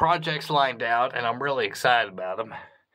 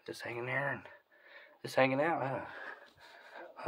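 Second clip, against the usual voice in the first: soft to a bat; 9 dB quieter, slower than usual. Real, not acted. Talking softly to bat.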